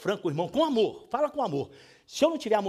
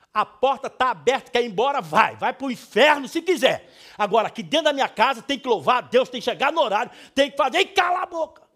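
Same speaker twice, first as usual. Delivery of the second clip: loud, commanding father laying down house rules; a father yelling house rules at a rebellious daughter; stern shouting; 4 semitones higher, 8 dB louder than usual. Shown as a demonstration. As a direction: bark orders like the head of the house. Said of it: "Eu grito assim com alguém".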